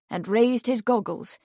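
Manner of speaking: usual speaking voice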